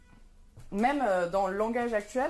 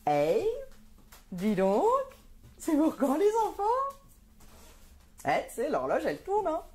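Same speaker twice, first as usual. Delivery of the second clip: pushy auntie asking about babies; nosy relative pressing, high and loud; nagging relative; 8 semitones higher, 3 dB louder than usual. Acted. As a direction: badger her about having kids, loud and high. Voicing nagging relatives about children.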